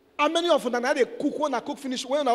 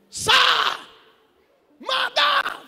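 high, loud preaching shout; preaching at full force; 11 semitones higher, 4 dB louder than usual. Acted. Preacher shouting warning to wives in church. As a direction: lift the voice and cry it to the church.

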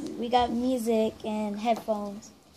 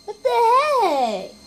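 surprised; 10 semitones higher, 8 dB louder, much slower than usual. Real, not acted. Baffled by a weird phrase.

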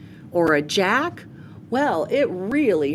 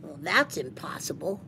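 inner thought; 3 semitones lower, 8 dB quieter, faster than usual. Acted. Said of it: "thought Spencer".

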